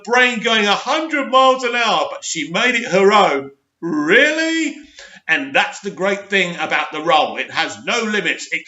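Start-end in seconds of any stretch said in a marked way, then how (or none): 3.8-4.8 much higher, incredulous "Really?"; scoffing at the praise; sarcastic disbelief. Real, not acted. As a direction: throw the question up in mock shock.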